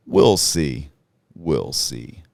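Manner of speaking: deep majestic voice, loud then receding; God. Retold or imitated. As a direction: pronounce it as the almighty, then fade away. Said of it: "in this very deep, majestic voice".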